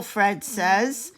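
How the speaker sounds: ordinary delivery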